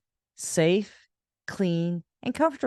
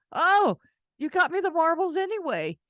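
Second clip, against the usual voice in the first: much higher amused dismay; mock surprise; 12 semitones higher than usual. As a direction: feign shock at the gift.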